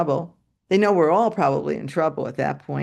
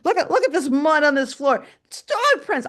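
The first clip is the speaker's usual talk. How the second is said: higher, louder accusing rant; angry principal; 9 semitones higher, 4 dB louder than usual. Retold or imitated. Principal scolding the teacher over dog prints, loud and high. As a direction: point at the mud, fuming. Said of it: "He goes".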